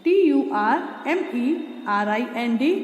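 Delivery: ordinary talk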